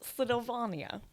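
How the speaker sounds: high, badly faked Russian accent. Shown as a demonstration. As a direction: attempt a Russian accent you can't really do. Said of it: "I can't do accents ever".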